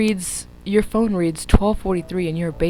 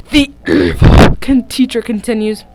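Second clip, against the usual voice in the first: evil teacher voice; 2 semitones higher, 10 dB louder than usual. Acted. Villain voice for teacher.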